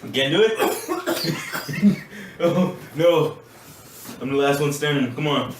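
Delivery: high, loud, slow teasing; gleeful taunt. Real, not acted. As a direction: gloat over the quitter.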